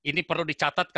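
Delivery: normal talking voice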